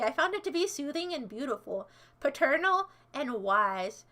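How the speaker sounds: usual speaking voice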